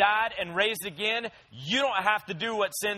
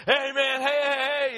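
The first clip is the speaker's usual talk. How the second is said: high, louder eager yaps of a happy dog; excited dog voice; 8 semitones higher, 4 dB louder than usual. Pretend. The puppy running onto the porch greets his owner, high and excited. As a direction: bounce up like a puppy greeting you. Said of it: "he's all excited".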